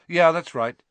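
high, loud quick reply; offhand schoolboy retort. A narrator's reading. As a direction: toss it off casually.

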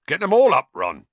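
high, loud hearty push; hearty urging. A narrator's reading. Hagrid coaxing Ron over the basin, loud and hearty. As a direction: cheer him on to get it all out.

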